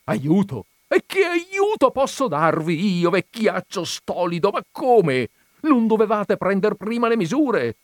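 high, loud exasperated scolding; shouting at old man. A narrator's reading. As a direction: cry out in indignant disbelief. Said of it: "gridò al vecchio".

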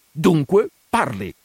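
loud, menacing command; threatening insistence; forceful. A narrator's reading. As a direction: push the word out with raw energy. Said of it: "Quel dunque fu proferito con una tale energia".

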